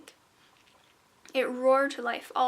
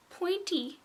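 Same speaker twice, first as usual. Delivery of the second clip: squeaky and higher, nervous; squeak; 7 semitones higher than usual. A narrator's reading. Frightened girl answering the gnome, squeaking. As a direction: squeak the word out timidly. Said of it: "she squeaked".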